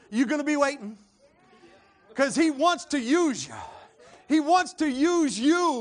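loud, high revival exhortation; full-force preaching. Real, not acted. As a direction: drive the altar call home.

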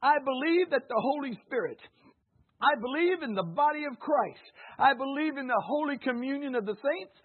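loud, ringing declaration of faith; preached creed. Acted. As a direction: proclaim each article of the creed forcefully. Recites creed at full force.

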